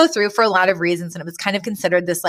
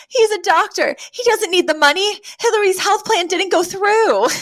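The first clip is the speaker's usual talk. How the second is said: much higher, hammed-up panicky defense; mock frantic plea; 11 semitones higher than usual. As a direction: squeak out excuses like a cornered woman. Fran pleads after being grabbed.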